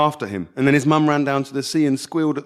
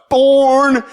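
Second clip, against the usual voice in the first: high, loud shouted word; boy star-jumping and shouting at the camcorder; 13 semitones higher, 8 dB louder than usual. Acted. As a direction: yell it into the camera lens.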